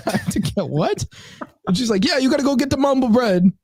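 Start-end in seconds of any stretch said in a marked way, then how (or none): 0.1-1.1 higher, softly incredulous mock question; baffled retold question. Customer can't follow deli worker, baffled retold question. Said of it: "I couldn't understand what she was saying". 2.1-3.5 very high mock Caribbean deli worker; imitated Caribbean accent. Deli worker repeats herself to customer, imitated Caribbean accent. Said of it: "this is the way she said it".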